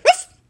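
loud high yapping woof woof; dog barks. Acted. Toby barks at the teddy bear, loud and high. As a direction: bark like an excited little dog. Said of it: "barked Toby".

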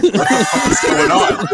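high, slow, incredulous laugh-talk; laughing disbelief. Retold or imitated. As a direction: retell your bewilderment while cracking up. Retold shock, cracking up while telling it.

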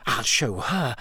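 tight, restrained anger; understated vengeful mutter; enraged. A narrator's reading. Hermione vowing to show Rita, enraged. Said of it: "shaking with rage".

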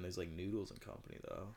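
usual speaking voice

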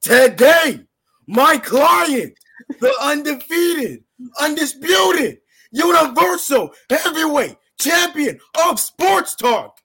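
wrestling manager voice. Acted. Wrestling manager intro bit.

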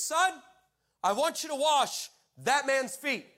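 high, loud, slow proclamation; grand, deliberate God voice, Son called out. Retold or imitated. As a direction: boom it out slowly as a divine command. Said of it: "then God speaks to me".

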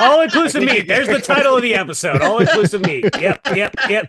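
laughing, naming the title. Real, not acted.

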